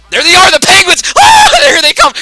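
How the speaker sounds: loud, high shocked yell with a laugh; high-pitched yell breaking into laughter; excited shout. Real, not acted. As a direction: shout it in disbelief at the rival. Spotting rival Penguins in bracket.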